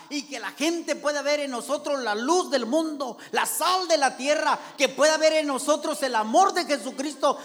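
loud, high, fervent pulpit preaching; shouted, rising sermon call to prayer; preaching at full force. Acted. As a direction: preach it with everything, pushing the congregation to seek the Lord.

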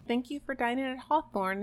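lightly voiced gracious host; mild sing-song goodbye. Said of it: "he's like".

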